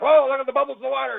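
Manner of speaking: hammed-up wide-eyed pilot; mock surprise. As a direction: gawk at the bubbles like a fooled pilot. Pilot voiced spotting bubbles.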